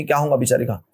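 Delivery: ordinary delivery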